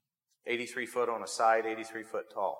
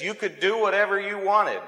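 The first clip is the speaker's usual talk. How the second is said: higher, exasperated outburst; 8 semitones higher, 5 dB louder than usual. Retold or imitated. Frustrated businessman giving up on the fisherman, exasperated. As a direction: throw up your hands and blurt it out. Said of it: "threw up his hands and he says".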